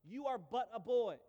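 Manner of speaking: king dismissing David slowly; slow put-down. Retold or imitated. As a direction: tell him slowly he is only a boy. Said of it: "And he actually says".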